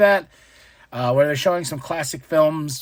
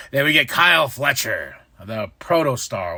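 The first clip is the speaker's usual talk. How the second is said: ordinary talk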